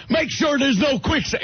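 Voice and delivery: cowboy boss giving orders; Slim Pickens accent. Retold or imitated. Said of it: "Him with that accent".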